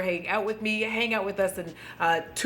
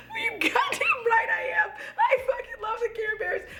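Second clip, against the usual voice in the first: much higher, shouting through laughter; tipsy squealing declaration; excited laughing shout. Real, not acted. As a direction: yell it in giddy joy. Drunk excited confession.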